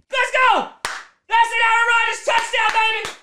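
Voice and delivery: high-pitched touchdown celebration; jubilant cheering. Real, not acted. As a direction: yell it like your team just scored.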